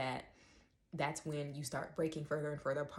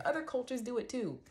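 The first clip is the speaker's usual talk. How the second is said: higher, whiny put-on voice; mocking commenter; 8 semitones higher than usual. Mocking a commenter who deflects, in a whiny voice. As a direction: mimic the defensive commenter with scorn.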